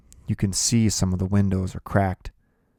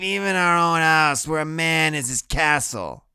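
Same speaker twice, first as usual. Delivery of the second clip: much higher, sing-song drunken mockery; slurred drunk mocking; 11 semitones higher, 4 dB louder than usual. Loretta mocking Preacher Kane about the booze rule. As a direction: tease the preacher, tipsy and sarcastic. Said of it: "her voice is slurpy".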